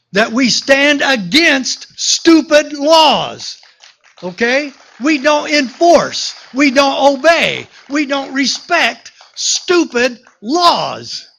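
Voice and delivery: slow, high and forceful, each we don't hammered; rallying cry. Acted. Sheriff rallying a Tea Party crowd, slow forceful high cry. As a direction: rouse the crowd, drawing every word out.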